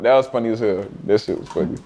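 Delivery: usual speaking voice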